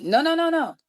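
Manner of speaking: high, rapid-fire string of refusals; alarmed refusal. Retold or imitated. Girl refusing to go down to the basement, alarmed.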